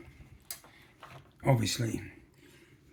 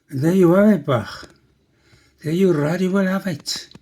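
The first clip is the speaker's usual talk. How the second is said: put-on loud, higher, singsong Welsh accent; lousy Welsh accent; 6 semitones higher, 7 dB louder than usual. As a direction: ham up a bad Welsh lilt. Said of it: "Just a lousy Welsh accent".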